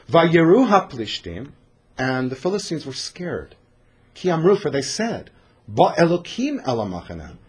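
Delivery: ordinary talk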